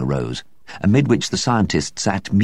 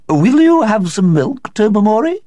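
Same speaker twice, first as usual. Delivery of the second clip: tense high hostess offer; strained; 7 dB louder than usual. A narrator's reading. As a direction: ask nervously in a strained high voice. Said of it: "in a rather strained voice".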